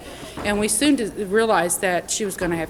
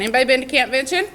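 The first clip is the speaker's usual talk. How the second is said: calling out; 5 semitones higher, 5 dB louder, much faster than usual. Real, not acted. Calls out to the audience.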